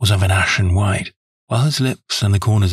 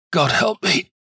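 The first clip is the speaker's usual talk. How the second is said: high, pained cry of despair; anguished cry; 7 semitones higher, slower than usual. A narrator's reading. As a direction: cry out to God, pitched high. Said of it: "he cried".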